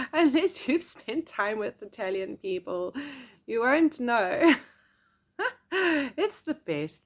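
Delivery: laughing fondly about Italians. Real, not acted. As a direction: laugh through it.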